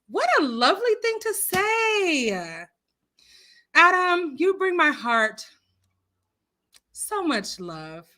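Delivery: high, drawn-out gushing coo. Real, not acted. Host thanks viewer for a lovely comment, gushing. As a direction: gush it slowly, swooping high.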